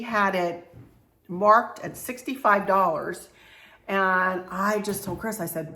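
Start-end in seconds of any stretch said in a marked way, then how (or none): none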